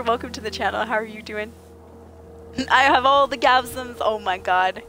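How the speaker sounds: normal talking voice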